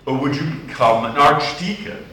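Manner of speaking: ordinary talk, nothing marked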